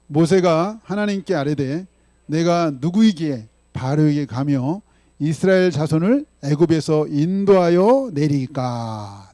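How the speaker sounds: slow, loud recitation of the verse; slow, loud congregational recitation; unison scripture reading. Acted. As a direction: read it out together, measured and strong.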